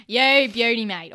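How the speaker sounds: joining the fake Australian accent; playful put-on Australian; mock Australian. Joins in the Aussie bit.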